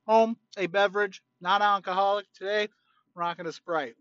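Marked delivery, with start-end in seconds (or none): none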